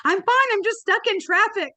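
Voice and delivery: high, loud, rushed panic; frantic apology. Retold or imitated. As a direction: gush a panicked sorry at the team. Retold panicked phone reply.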